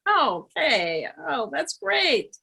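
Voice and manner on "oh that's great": ordinary talk, nothing marked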